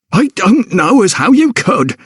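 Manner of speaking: high scolding cook's voice. A narrator's reading. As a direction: scold the maid sharply, pitched high. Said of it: "scolded Mistress Claddingbold".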